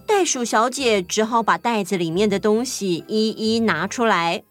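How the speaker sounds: ordinary delivery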